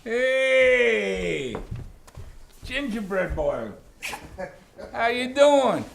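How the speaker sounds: high, slow and fond, calling to the little ones; doting grandpa greeting. Real, not acted. Grandpa greeting his grandsons, high and doting. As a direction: coo up high and bright at the grandkids.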